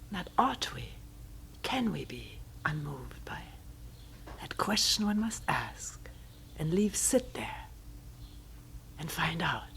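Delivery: soft hushed pondering, slowing; whispered teaching aside; whispered question. Real, not acted. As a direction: breathe the question out.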